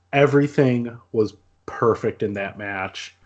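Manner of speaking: ordinary talk, nothing marked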